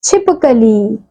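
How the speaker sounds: each word said clearly and apart, with pauses between; word list read aloud. Shown as a demonstration. Teacher reading a word list for the children to repeat, clearly. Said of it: "शब्दों का उच्चारण कीजिए".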